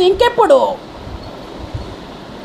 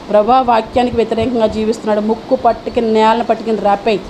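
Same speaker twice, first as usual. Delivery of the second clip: mock curse prayer; 8 semitones lower than usual. Pastor mimics the congregation's spiteful prayer, low and hushed. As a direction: mimic a believer praying curses on others. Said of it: "మనం చేసే ప్రార్థన వాళ్ళకి అలా వెళ్తుంది".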